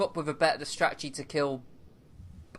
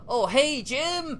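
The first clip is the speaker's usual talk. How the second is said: high, bright mock cabbie greeting; cheesy taxi driver voice; 11 semitones higher, much slower than usual. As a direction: ham up a chirpy New York cabbie. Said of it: "it's just, uh".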